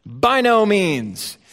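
slow, weighty By no means; emphatic. Retold or imitated. Preacher voicing Paul's answer, slow and emphatic. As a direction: press each word down. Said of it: "It's emphatic".

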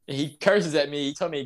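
ordinary talk, nothing marked

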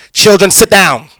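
a hint of a stern, loud teacher barking at kids; bitter teacher voice. Pastor imitating a soured friend scolding children. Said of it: "She's gonna come in and say".

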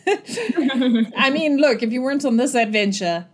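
ordinary talk, nothing marked

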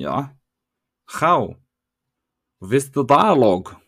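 hammed-up German accent, questioning then answering; mock German accent. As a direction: lecture in a fake German accent.

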